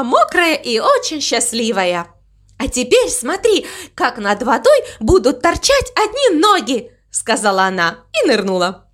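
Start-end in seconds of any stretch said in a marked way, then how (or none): 2.6-6.9 high, eager cow voice; excited announcement. Acted. Mama Mu announces the next trick, high and eager. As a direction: show off, pitch held up.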